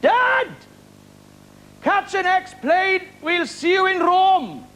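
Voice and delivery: high calling shout, drawn out slowly; shouted from the plane. Retold or imitated. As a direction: yell to dad down on the tarmac. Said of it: "I shouted from the plane window".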